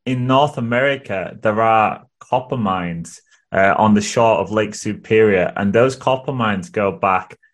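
ordinary talk, nothing marked

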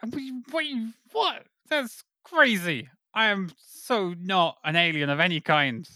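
high, flustered, caught-out protest; panicked denial. Acted. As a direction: squeak out a nervous denial. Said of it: "Why are you talking like a, an alien".

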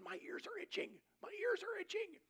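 mock astonished yelp, rising in pitch; excited outcry. Healed pastor cries out about his ears, imitated excited high shout.

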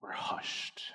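barely whispered close. Shown as a demonstration. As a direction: let it fall to a whisper. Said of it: "stilled the storm to a whisper".

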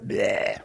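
short, low mock bleat; imitated calf bleat. Calf refusing what God offers, imitated bleat. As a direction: cut in with a calf's "bleh". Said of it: "as that calf that I was trying to feed".

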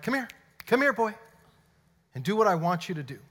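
mock dog-owner command, starting high and loud; calling a dog. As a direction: summon God like a dog. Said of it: "treat God ... like a D O G".

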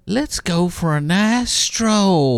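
slow, drawn-out preacher croon; Joel Osteen voice. Retold or imitated. Mocking the pastor, slow and soothing. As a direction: stretch it like a gentle TV pastor. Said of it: "I believe he'd call it a stroll".

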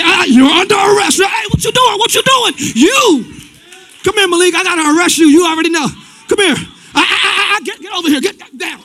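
lightly voiced cop barking at a suspect; mock police officer. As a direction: play a rough cop arresting someone, a bit loud. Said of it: "I gotta say".

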